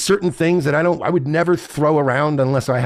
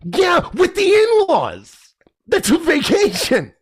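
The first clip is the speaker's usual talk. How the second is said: much higher, slow, whiny mock voice; mocking put-on voice; slower than usual. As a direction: stretch it out in a high, prissy parody. Mocking the couple's defense.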